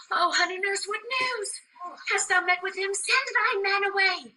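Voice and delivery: high, excited rush of questions; eager. Acted. Juliet greeting her nurse returning with news, eager.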